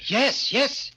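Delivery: eager yes. Acted. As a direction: jump at the chance. Eagerly volunteers.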